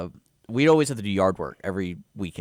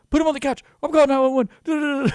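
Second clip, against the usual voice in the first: very high, louder mock frantic mom; panicked mom; 7 dB louder than usual. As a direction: bark orders like a frightened mother.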